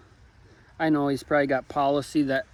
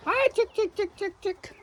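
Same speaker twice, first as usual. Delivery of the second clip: high sing-song chick call; sweet high coaxing call to hens; calling chickens; 5 dB quieter than usual. Real, not acted.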